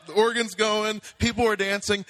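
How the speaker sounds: loud, high-pitched rush of excitement; excited storytelling. Real, not acted. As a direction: keep the shouted energy up as the scene erupts. Excitedly retelling the church erupting.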